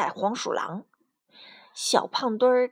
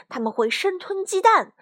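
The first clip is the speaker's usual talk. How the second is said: raised, anxious warning; tense, breathless alarm; 7 semitones higher, 5 dB louder than usual. A narrator's reading. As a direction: warn of horrors with mounting alarm. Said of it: "卡梅拉紧张得透不过气来". (紧张惊恐地警告)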